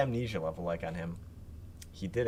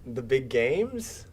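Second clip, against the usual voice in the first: cheesy, pushy TV-ad pitch; infomercial voice; 4 semitones higher, 4 dB louder than usual. Acted. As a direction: sell it like a late-night ad host. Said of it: "He's talking like an infomercial".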